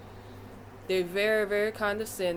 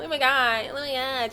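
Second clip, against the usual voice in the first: put-on higher, airy valley girl gush; 6 semitones higher, 3 dB louder than usual. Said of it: "trying to talk like a valley girl".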